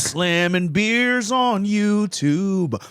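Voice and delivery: slow, high, drawn-out reveal; slow drawn-out announcement. Pretend. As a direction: stretch every word out. Mock announcer line.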